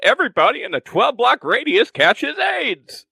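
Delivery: lightly voiced old man. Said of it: "retirement episode".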